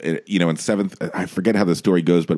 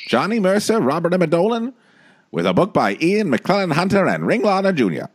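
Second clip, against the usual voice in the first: put-on high-pitched old-time show announcer; old-timey announcer; 10 semitones higher than usual. Host announcing a revival's writers to listeners, old-timey announcer voice. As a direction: announce the credits like a vintage emcee. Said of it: "that's the old-timey voice that would announce things".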